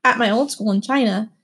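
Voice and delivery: ordinary delivery, nothing marked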